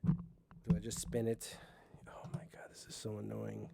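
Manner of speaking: frustrated whisper. Real, not acted. Host fixing the stand alone, frustrated whisper. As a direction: mutter it breathily while fixing gear. Fiddling with stuck mic stand.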